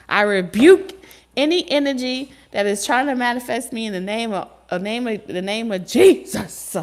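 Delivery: loud hammed-up preacher rebuke, slowing on Jesus; mock rebuke prayer.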